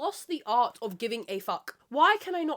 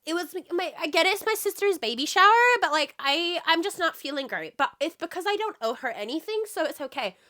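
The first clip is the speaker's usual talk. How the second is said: mocking flaky friend; 7 semitones higher, 5 dB louder than usual. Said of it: "the people that are like".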